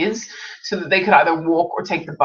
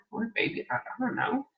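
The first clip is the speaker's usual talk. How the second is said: puzzled reader; 3 semitones higher, 13 dB quieter than usual. Retold or imitated. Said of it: "you're like".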